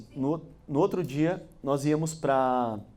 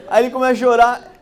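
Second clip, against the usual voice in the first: laughing; 6 semitones higher, 7 dB louder than usual. Real, not acted. Laughing about father crying.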